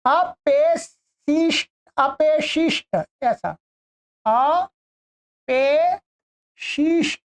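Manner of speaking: अपेशिष्ट stretched very slowly syllable by syllable; drawn-out demo. Shown as a demonstration. Teacher slowly sounding out अपेशिष्ट for students. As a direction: spell out अपेशिष्ट slowly for the class. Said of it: "ये बनेगा".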